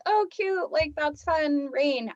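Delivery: high, chirpy mock delight; sugary fake cheer; mock cute. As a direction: sing it like light cute rain. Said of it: "I don't mean, like".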